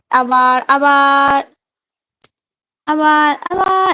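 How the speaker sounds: drawn out and loud, cheering again; excited coaxing. Real, not acted. Urging child to do again.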